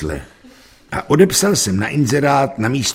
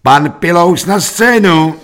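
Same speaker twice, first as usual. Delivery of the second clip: high, loud, drawn-out summons; calling out; 8 semitones higher, 7 dB louder, slower than usual. A narrator's reading. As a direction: call the next performer to the stage. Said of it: "V tom zaznělo volání".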